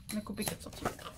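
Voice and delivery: breathed aside, no voice; whispered repeat. Real, not acted. Repeating the step to herself, whispered. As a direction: whisper it to yourself.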